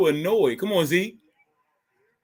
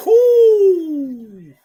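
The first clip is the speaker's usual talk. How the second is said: high, loud whoop; celebratory whoo; 14 semitones higher, 11 dB louder than usual. Real, not acted. Fan celebrating the Cowboys lead, loud high whoo.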